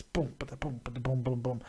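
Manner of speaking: soft, low rhythmic booms; mouthed drum beat. Real, not acted. Host filling time on air, soft low drum noises. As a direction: tap out a quiet beat with the voice.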